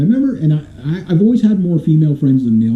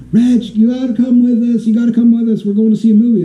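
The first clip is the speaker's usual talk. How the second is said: higher-pitched imitation of eager friends; female friends; 6 semitones higher than usual. Female friends urging him to a movie, mock high voice. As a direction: pitch it up as pleading girlfriends. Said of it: "all my female friends".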